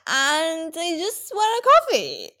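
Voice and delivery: put-on drunk, slurring woman, high and drawn out; slow, high impression of someone wasted; [name]. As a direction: slur it slowly, like a mock drunk. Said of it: "She sounds like she's drunk".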